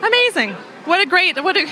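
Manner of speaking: usual speaking voice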